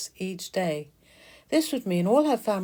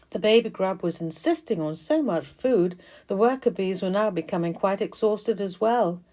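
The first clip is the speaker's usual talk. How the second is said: usual speaking voice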